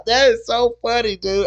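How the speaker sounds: high, laughing delivery. Real, not acted. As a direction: still cracking up. Laughing at chat comment.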